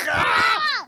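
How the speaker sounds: very high, loud shrieking roar; loud roar scream. Acted. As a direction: shriek like a dinosaur pouncing. Dinosaur attack in story.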